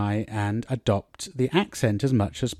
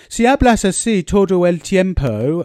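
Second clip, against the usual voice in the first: put-on English accent in Spanish; 6 semitones higher, 5 dB louder than usual. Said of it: "speaking Spanish with an English, sort of quasi-English accent".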